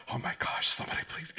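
hushed, whispered begging; whispered plea. Acted. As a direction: whisper it like a guilty schoolkid praying. Acting out guilty schoolkid's inner plea.

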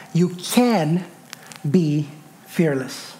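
"can" set off by a pause before "be fearless"; stress on can. Shown as a demonstration. Preacher making point three, "can" stressed and followed by a pause. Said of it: "emphasis on that word can".

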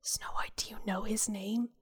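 hushed secret whisper. Acted. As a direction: whisper it so no one hears. Calling to the prince in secret.

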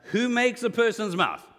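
God teasing a reluctant Moses, cheeky and loud. Acted. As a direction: toss the question out with cheek. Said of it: "is how I would say it. That'd be my tone".

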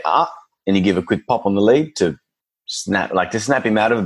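normal talking voice

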